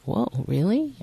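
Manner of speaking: retold "Whoa, really?" rising high; a touch of wide-eyed amazement; amazed. Wife hearing God gave a financial plan, amazed.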